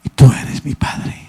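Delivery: slow, breathy hushed whisper to God; whispered prayer. Retold or imitated. Man whispering his first prayer to God, slow and hushed. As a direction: breathe the words like a private prayer.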